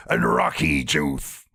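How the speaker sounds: low mumbling Stallone impression; Sylvester Stallone voice. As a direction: mumble it like Rocky. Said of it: "either a Sylvester Stallone impersonation or he's having a stroke".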